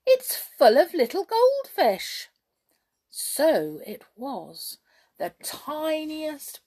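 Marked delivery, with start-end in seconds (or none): 0.0-2.3 high, loud amazed report. A narrator's reading. Jump marvels at goldfish in the cup, high and loud. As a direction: tell it in wide-eyed wonder.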